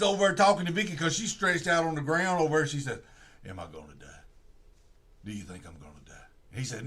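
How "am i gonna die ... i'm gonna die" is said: faint dying voice. Retold or imitated. Dying Vicky asks detective.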